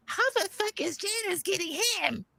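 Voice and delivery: put-on high, quiet gossipy old lady voice; old ladies gossiping. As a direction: squeak it like a scandalized granny. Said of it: "and they're like".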